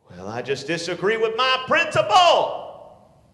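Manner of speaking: slow, loud whining student; whiny student. Retold or imitated. Student griping about the principal, loud and whiny. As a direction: drag it out like a sulky kid.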